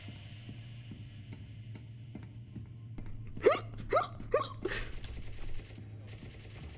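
quiet, high wheezy giggle; helpless soft giggling; stifled laughter. Real, not acted. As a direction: laugh softly in little bursts. Laughing during play.

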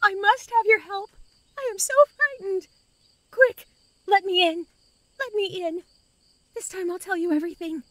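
high, pleading, desperate; desperate urgency. Acted. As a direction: beg to be let in, terrified. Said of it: "desperate urgency in her voice".

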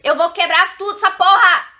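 loud, high-pitched outburst of frustration; furious yell. Real, not acted. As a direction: explode at the noise. Outburst at interrupting noise.

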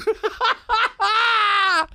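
real high-pitched laugh; high laughing fit. Real, not acted. Cracking up at own story, high laugh. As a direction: burst out laughing.

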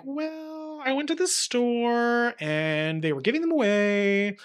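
a light, high child voice, slowed down, playing innocent; childlike reply. A child answers her mom in a high, slow, innocent voice. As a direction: act out the little girl caught with the apples.

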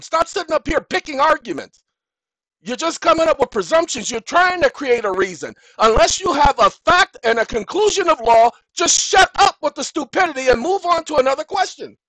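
shouted high and loud, rising to a furious peak; angry rant. Acted. Firing back at the court's nitpicking, furious shout. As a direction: tell the court off and shut them down. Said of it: "that's my response to them".

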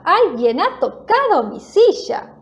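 lightly hammed-up annoyance with swooping pitch; irritated voice. A narrator's reading. Mama bear finds her chair touched, mildly irritated. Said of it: "con voz irritada".

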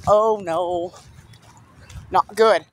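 normal talking voice

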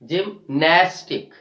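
"Gymnastic" slowed, split before a strong NAS; stress on NAS. Shown as a demonstration. Teacher modelling second last syllable stress, slow "gymNAStic". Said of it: "We are stressing on the second last syllable".

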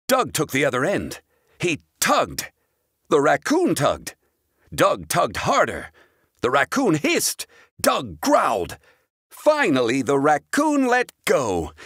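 ordinary delivery

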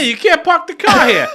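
high, loud mock Boston yell; Boston accent. Shown as a demonstration. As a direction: bellow it like a Boston local. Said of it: "Hey, you do yours now".

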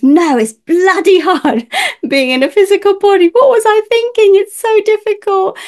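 put-on the baby's soul groaning with a laugh that it's too hard. A healer retells the newborn's soul complaining, high, laughing and loud. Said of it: "and he says".

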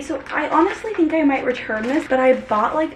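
normal talking voice

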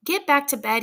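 agitated, urgent command; shaking voice. A narrator's reading. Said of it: "his voice shaking".

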